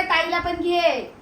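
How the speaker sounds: high, slow singsong toddler imitation; imitating toddler. Mother imitating her toddler to her sister-in-law, slow and high. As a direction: stretch it out like a little kid asking. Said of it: "Kittu बोलतोय".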